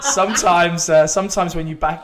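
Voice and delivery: laughing. Real, not acted. Joking about huge loss.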